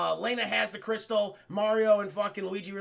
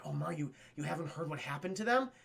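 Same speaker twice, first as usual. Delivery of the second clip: low, hushed ominous aside; 6 semitones lower, 7 dB quieter, faster than usual. Retold or imitated. Daisy breaking bad news to Mario, low and ominous. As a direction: drop it down, foreboding and soft. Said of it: "Daisy makes it very ominous".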